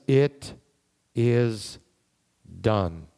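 each word set apart by a long pause; solemn, word-by-word pronouncement, falling at the end; great voice. Acted. Voice from the throne declares the work over, great voice. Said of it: "there came a great voice out of the temple".

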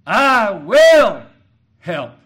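preaching emphasis, word by word; stress on will. Shown as a demonstration. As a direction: punch will with everything. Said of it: "he emphasized each word".

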